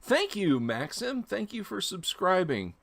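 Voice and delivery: high, bright burst of gratitude; excited thanks. Real, not acted. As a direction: thank them with a lift in the voice. Thanking a new subscriber live.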